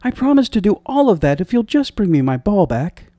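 high, eager princess promise; high-pitched princess pleading for her ball; exclaimed. A narrator's reading. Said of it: "the princess exclaimed".

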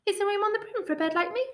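high, squeaky talking bird; high-pitched bird asking politely; little bird voice. A narrator's reading. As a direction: pipe up high like an eager bird. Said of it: "with an ear-splitting shriek".

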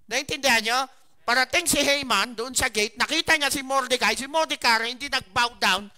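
loud, high fiery sermon telling; preaching at full force. Acted. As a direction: drive the story home to the congregation. Preaches the Haman story loudly. (nangangaral nang buong lakas at malakas)

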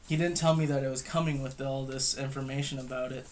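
ordinary delivery

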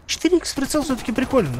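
ordinary talk

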